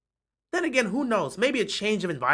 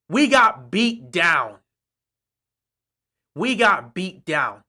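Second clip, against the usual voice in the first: slow grim repeat; slower than usual. Real, not acted. Fan admitting the loss, slow and grim. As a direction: drag it out slowly.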